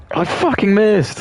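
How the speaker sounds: high, drawn-out wail of frustration; frustrated outburst. Real, not acted. Player rages at own miss, frustrated outburst. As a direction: burst out high and slow at the miss.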